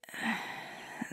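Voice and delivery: soft, airy exhale; resigned sigh. Acted. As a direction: sigh quietly, giving in. Resigned before calling herself selfish.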